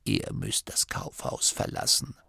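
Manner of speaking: dying abbot's whisper. A narrator's reading. The dying abbot whispering a secret into Masklin's ear. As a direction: breathe it out like a frail dying old man.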